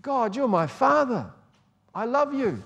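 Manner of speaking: high, heartfelt cry of love; crying out to God. Retold or imitated. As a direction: call out to God with longing. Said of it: "to make us want to cry out".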